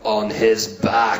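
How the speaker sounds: low, very slow insistence; hard-man order, drawn out word by word; tough first aider. Acted. First-aid guy overruling the doctor, low and slow. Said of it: "toughest first aid guy".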